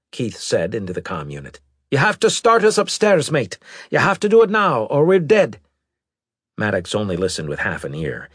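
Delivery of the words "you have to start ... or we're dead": loud, high-pitched urgent plea; desperate urging. Acted. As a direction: urge your mate over the comm. Pilot pleads over comm for rescue.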